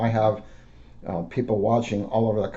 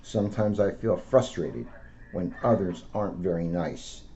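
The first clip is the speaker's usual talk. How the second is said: gentle storybook read-aloud; a police officer reading to children; 4 dB quieter than usual. Acted. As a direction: read it out to kids like a picture book. Officer reads picture book aloud.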